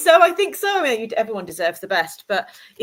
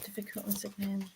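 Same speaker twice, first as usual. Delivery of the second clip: ordinary delivery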